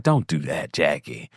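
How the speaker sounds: low, soft pleading, imitated; lightly voiced soothing friend, low and soft; imitating Heavenly. Heavenly stopping Jackie's tears, low soft plea. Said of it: "She's like".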